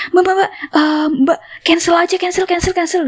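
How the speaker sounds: high, rapid-fire repeated plea; panicky urging. Retold or imitated. Driver urging passenger to cancel the order, high and panicky. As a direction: imitate a flustered driver urging action.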